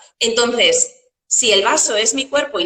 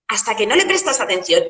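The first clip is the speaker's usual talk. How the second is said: normal talking voice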